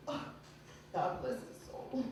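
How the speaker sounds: slow whispered prayer; whispered mock mourning.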